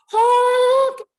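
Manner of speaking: big storybook honk; loud honk. A narrator's reading. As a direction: make the horn blare. Horn honks in story.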